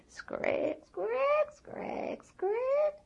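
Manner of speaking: muted see-saw squeak; rocking chair creak. Acted. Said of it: "you could hear her rocking".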